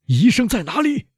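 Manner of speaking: high, strained shouted question; frantic high-pitched cry; urgent shout. A narrator's reading. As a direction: demand the doctor in alarm. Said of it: "威勒夫喊道".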